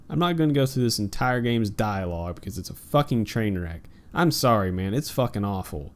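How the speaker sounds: ordinary talk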